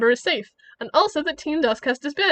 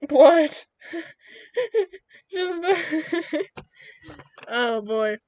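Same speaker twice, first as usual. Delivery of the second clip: laughing; 3 semitones higher, much slower than usual. Real, not acted. Streamer laughing at her own story.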